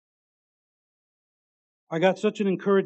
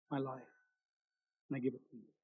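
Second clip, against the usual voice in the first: much softer, low and hushed; hushed surrender; 5 semitones lower, 13 dB quieter, much faster than usual. Retold or imitated. Preacher voices niece giving her life to God, hushed. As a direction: let it fall to a soft offering.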